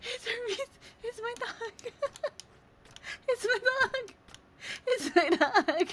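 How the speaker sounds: high, shrieking cries of joy; tearful squeal as she recognises her dog; excited crying. Real, not acted. Streamer seeing her dog Hermes in the game, crying with joy.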